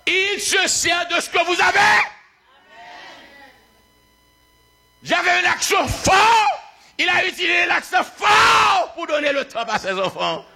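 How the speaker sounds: high, shouted proclamation; full-force preaching. Real, not acted. Preacher testifying about his accent, full-force preaching. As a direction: cry it out to the whole congregation.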